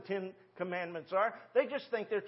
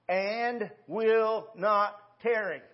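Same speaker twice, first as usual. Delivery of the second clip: 1 very slow emphasis; 4 dB louder, much slower than usual. Acted. Preacher stresses key phrase.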